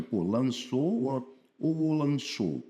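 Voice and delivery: slow, deliberate comparison of two orders; drawn-out thinking aloud; slow weighing. Shown as a demonstration. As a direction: spell out both word orders slowly. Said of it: "Aí eu falei".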